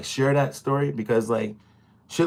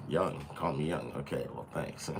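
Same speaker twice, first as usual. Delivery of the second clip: low, much softer mock thanks; deadpan mumble; 11 semitones lower, 15 dB quieter than usual. Replying to being called young in a low soft mumble. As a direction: mutter it, unimpressed. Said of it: "I'm like".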